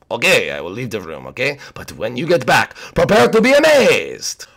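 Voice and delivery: hammed-up French accent; theatrical French-accented chef; French Koopa. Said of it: "French Koopa, leave the room".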